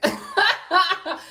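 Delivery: mock burst of uproarious laughter; imitated hearty laugh. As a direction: laugh like easygoing officers having fun. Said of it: "Them Irish people were like".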